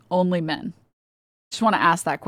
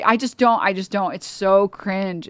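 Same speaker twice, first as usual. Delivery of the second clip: ordinary delivery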